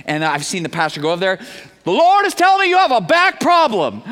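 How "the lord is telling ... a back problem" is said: high, loud showman preacher imitation; mock prophetic proclamation. Pastor announcing a revelation to a congregant, mock proclamation. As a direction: proclaim it like a showy fake prophet.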